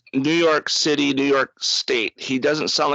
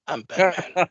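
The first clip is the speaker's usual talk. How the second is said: mock Batman growl breaking into a laugh; 4 semitones higher than usual. Podcaster redoing the Batman line while laughing at it. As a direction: try the gravel voice but crack up. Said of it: "gargling battery acid".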